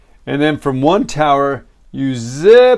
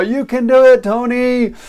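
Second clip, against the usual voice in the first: wife cheering; 10 semitones higher than usual. Retold or imitated. Wife cheering husband on the tower, high call. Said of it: "My wife's all".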